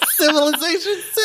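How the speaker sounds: high, helpless laughter; cracking up mid-line. Real, not acted. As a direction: burst out laughing at the reveal. Reveal of own quote.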